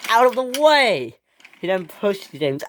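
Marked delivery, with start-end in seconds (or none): 0.1-1.2 high, loud, angry command; furious storybook shout; screamed order. Acted. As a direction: yell at him to shift. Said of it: "screamed Gordon".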